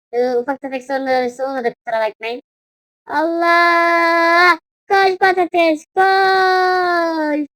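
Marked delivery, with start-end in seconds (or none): 3.1-7.5 high, drawn-out shout; frantic urging. Real, not acted. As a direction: yell to get someone running. Urges character to run. (telaşla bağırarak)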